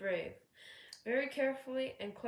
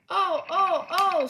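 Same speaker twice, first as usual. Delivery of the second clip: high, loud wailing oh; truck wail; 9 semitones higher, 15 dB louder, slower than usual. A narrator's reading. A truck groaning as James backs down, loud and high wail. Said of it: "said the truck".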